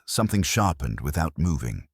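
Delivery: ordinary delivery, nothing marked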